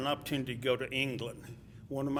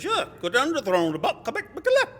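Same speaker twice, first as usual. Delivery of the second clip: high, loud mimicry of a local man; imitated local man; 12 semitones higher, 10 dB louder, faster than usual. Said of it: "could you do that a little slower".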